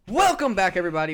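big booming host welcome back; loud welcome. Real, not acted. Host opening the show to listeners, loud and bright. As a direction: greet the listeners loudly.

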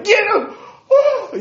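very high, loud comic shriek; cartoonish high squeal of fright; mock scared yelp. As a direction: yelp in hammed-up alarm. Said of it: "he was a little scared".

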